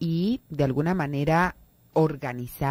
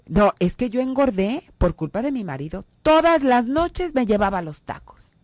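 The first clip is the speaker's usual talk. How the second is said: higher, louder whiny excuse to the doctor; a hint of a whining wife blaming her husband; complaining patient; 6 semitones higher, 4 dB louder than usual. Patient whining to her doctor about her husband, mock complaint. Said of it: "cuando dicen".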